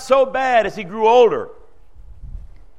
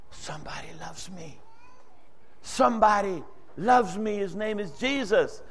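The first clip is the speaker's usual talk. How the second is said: quiet realization; 8 dB quieter than usual. Retold or imitated. Man realizes someone loves him.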